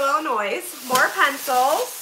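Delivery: ordinary delivery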